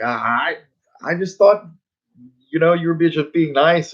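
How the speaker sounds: normal talking voice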